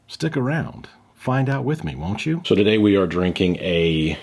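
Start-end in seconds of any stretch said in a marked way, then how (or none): 0.1-2.4 playful host tease. Acted.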